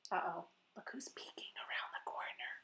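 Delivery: quick, hushed whisper; whispered suspense. Acted. Storyteller hinting to kids, hushed whisper. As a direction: whisper it like a secret.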